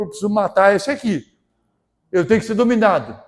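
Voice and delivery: loud, driving preacher's exhortation; fervent preaching. Real, not acted. Preacher urging the congregation to decide, fervently. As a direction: push the church toward a decision.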